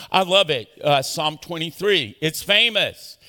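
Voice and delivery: ordinary delivery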